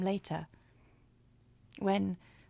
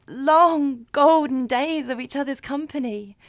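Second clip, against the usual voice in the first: louder and higher, swept up in it; rapturous; 6 semitones higher, 11 dB louder than usual. Acted. Woman likening first dates to spring and summer, rapturous. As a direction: gush about the golden days of new love. Rapturous picture of new love.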